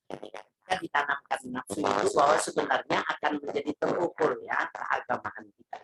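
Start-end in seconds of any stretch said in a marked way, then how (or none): none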